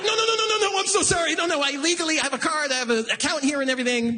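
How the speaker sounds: high, shouted frantic backpedaling; hammed-up panic at the teller; panicked apology. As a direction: plead with the scared tellers.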